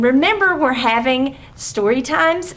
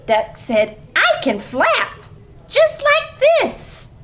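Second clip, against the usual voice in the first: put-on bright read-aloud for small kids; lively story reading; 7 semitones higher than usual. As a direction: read it like a playful picture-book story time. Reading picture book aloud.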